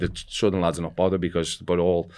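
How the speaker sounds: normal talking voice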